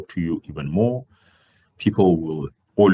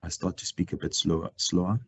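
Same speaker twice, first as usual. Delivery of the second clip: quiet and slowing; 2 semitones lower, 8 dB quieter than usual. Shown as a demonstration. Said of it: "my voice starts to get quiet".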